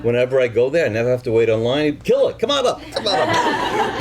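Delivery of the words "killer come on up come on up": high, laughing call of Killer; high laughing bouncer call; laughing welcome. Retold or imitated. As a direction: greet him like a regular, through laughter.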